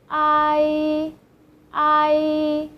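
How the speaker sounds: "I" as ah plus e run quickly together; joined ai. Shown as a demonstration. Teacher joining two vowels for learners, clearly. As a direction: glide ah into e fast. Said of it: "say it quickly and then join the sound".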